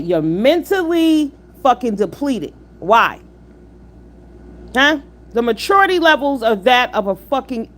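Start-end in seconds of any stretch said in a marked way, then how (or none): none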